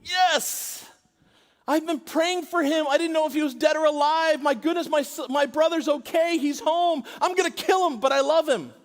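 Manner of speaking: put-on older brother overjoyed at his lost brother's return, shouting happily. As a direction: shout the joy of a brother come home. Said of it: "don't you want the brother to go".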